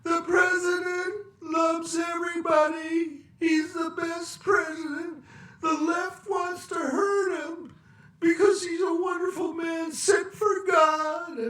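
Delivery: very high, sing-song gushing; mocking press secretary. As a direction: gush like a starry-eyed spokesperson. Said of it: "And even my favorite".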